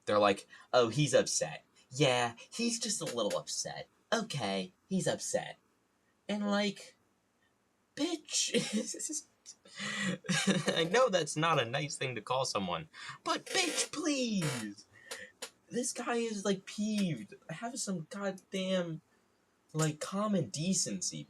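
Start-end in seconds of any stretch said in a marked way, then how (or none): 0.7-5.6 higher, mock gossiping aunts; lightly voiced obnoxious aunts; obnoxious commentary. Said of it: "obnoxiously loud about his actions". 6.3-9.2 very high squeaked reaction; comic falsetto indignation; mock outrage. 10.7-12.9 higher voice through real laughter; amused, giggling admission; laughing. Real, not acted. Laughs at own insult. 13.2-21.3 very high falsetto scolding; exaggerated high-pitched exasperation; mock indignation. Mock telling-off of the aunts.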